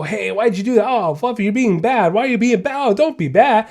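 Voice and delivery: high, pleading nag at the dog; whiny scolding conversation. Shown as a demonstration. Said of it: "I don't have a conversation with them".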